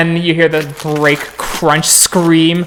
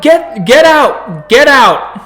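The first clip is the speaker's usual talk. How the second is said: raised, urgent cop order; barking order; 8 semitones higher, slower than usual. Acted. Cop clearing homeless people from their camp, raised urgent order. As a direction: order them out in a higher voice.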